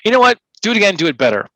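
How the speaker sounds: high, loud bossy director; mock demanding director. Coach imitates an artistic director, high and loud. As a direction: bark it like an impatient director. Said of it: "they say".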